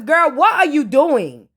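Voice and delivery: usual speaking voice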